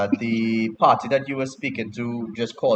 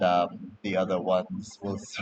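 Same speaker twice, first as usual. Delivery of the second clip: ordinary delivery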